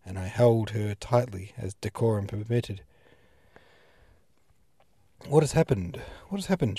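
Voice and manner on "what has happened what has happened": trembling cry, rising higher; frantic, shaking questions. A narrator's reading. Madame Buddenbrook cries out to the pastor, trembling. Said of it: "she cried, all trembling".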